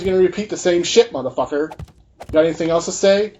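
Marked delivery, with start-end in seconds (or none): none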